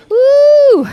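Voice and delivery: high loud whoop; cheering whoop. Real, not acted. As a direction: whoop to pump up the class. Trainer cheering mid-workout.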